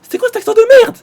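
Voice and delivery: high, loud outraged yell; mocking yell. Real, not acted. Gamer yelling at the roleplayer about his silly accent, high and loud. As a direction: shout it at him, scandalised.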